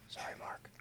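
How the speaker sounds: whispered apology; whispered aside. Real, not acted.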